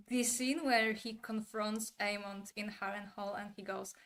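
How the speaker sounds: ordinary talk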